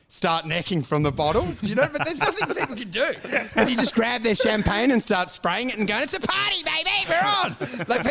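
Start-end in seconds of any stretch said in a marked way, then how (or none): 6.0-7.6 high-pitched party-starter whoop, no extra volume; mock party cry. Reveller spraying grabbed champagne, high mock party cry.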